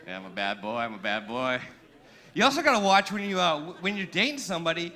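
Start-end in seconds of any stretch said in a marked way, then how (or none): 0.1-1.6 low-pitched, playful self-scolding; mock naughty confession. Comic owning up after a dirty joke, low mock confession.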